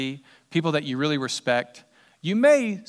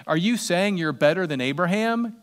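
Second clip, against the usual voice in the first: normal talking voice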